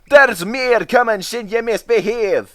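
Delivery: high stern Scottish warning; severe threat. Acted. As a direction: threaten the van firmly. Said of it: "said Donald severely".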